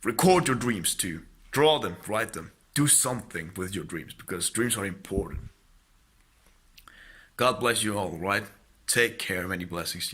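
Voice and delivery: loud, rising preacher's exhortation; fervent preaching. Real, not acted. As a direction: preach it out to the listeners. Preaching closing blessing.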